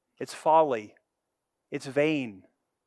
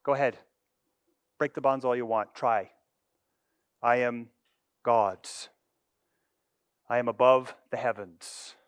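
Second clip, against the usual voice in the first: mocking God; 5 semitones lower, 5 dB quieter than usual. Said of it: "He laughs".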